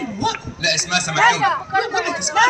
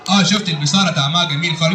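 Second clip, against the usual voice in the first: very low-pitched puppet character; Aragoz puppet; 14 semitones lower than usual. Acted. Puppet explaining his visit to the host, Aragoz voice. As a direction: play a comic puppet, far below your own pitch. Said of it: "الأراجوز ده وهو بيرغي".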